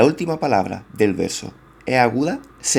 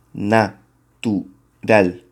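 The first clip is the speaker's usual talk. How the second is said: Natural stretched very slowly, each syllable split apart as na-tu-ral; much slower than usual. Shown as a demonstration. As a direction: count out Natural one syllable at a time, slowly. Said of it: "Llevamos una".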